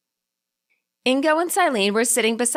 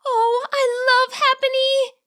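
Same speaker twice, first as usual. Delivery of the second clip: much higher, slow and sighing; sighing spirit voice; slower than usual. A narrator's reading. Spirit gushes to Indigo, slow high sigh. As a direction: swoon over the Happiny with a sigh. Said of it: "she sighed".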